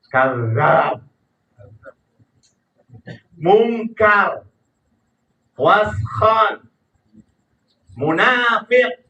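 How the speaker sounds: harsh demo of negative words; unpleasant intonation. Shown as a demonstration. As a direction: hit each word hard, one by one. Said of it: "intonasinya nggak enak". (intonasi keras, nggak enak didengar)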